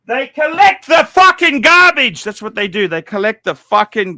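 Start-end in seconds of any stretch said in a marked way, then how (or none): 0.1-2.2 raised, higher angry rant; ranting shout. Real, not acted. Venting to stream viewers about garbage watches, shouting. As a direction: bark it out in disgust.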